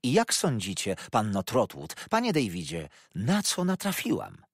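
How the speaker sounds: high, loud, theatrical gossip; breathless, dramatic telling; mother relaying scandal, agitated; excited Mrs Markleham. A narrator's reading.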